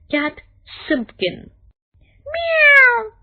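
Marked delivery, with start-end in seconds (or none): 2.3-3.1 much higher, loud mewing cat; cat meow. A narrator's reading. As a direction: let the cat cry out. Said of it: "said the cat".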